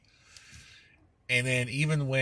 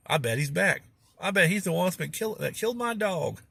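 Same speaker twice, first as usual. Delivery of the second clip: put-on old country man voice; 7 semitones higher, 4 dB louder than usual. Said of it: "he goes".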